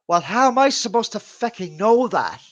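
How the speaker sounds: high, loud retold protest; mock exasperated mom. Mother to son after his prank, mock exasperation. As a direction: imitate a mother protesting loudly.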